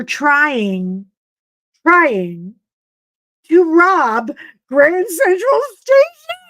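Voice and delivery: rising into a loud high-pitched exclaim; incredulous outburst. Retold or imitated. Telling listeners about grandfather's crime, loud disbelief. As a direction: blurt out the shocking family secret.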